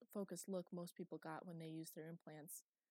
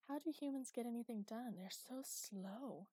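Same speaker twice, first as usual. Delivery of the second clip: higher, then very slow and dragged; impatient; 5 semitones higher than usual. Acted. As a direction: sigh at how slow humans are. AI thinks impatiently.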